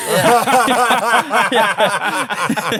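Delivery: real loud high laughter over the words; laughing hard. Real, not acted. Telling the podcast host about the note, laughing out loud. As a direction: crack up loudly.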